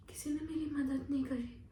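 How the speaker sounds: usual speaking voice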